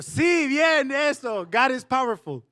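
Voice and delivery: high, loud burst of praise; retold father's triumphant "yes!"; excited approval. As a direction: cheer them on for getting it. Retold relieved father cheering his daughters on.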